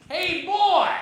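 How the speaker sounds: high drawn-out hillbilly holler; cartoon hillbilly. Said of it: "cartoon hillbilly".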